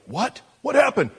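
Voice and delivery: high-pitched, incredulous questioning; mock alarm, jumping up in pitch; shocked friends. Old friends hearing "I'm saved," shocked reaction.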